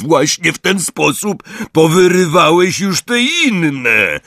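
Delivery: high, loud reproach; scolding shout. A narrator's reading. Hagrid scolding the giant over uprooted trees, loud shout. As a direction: shout a telling-off, stretching the end. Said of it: "krzyknął Hagrid".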